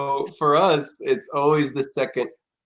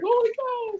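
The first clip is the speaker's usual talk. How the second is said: high amazed gasp; 4 dB quieter than usual. Retold or imitated.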